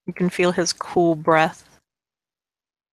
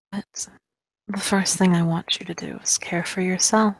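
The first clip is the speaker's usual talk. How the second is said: hushed, eerie gravedigger voice; creepy gravedigger whisper. Acted. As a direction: breathe it like a creepy old gravedigger. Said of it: "I'm channeling my inner gravedigger for this".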